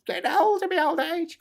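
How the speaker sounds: high strained mock lament; mock old-age whine. Streamer excusing a misread name, high mock whine. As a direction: whine it like a creaky pensioner.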